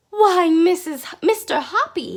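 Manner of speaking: very loud, high burst of joy; joyful exclamation; delighted cry. A narrator's reading. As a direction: cry out in happy surprise. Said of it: "she cried".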